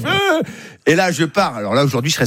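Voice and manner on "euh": very high mock holler; wordless high cry, playfully imitated; imitated fan yell. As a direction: mimic a yelling German fan. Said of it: "un Allemand qui crie".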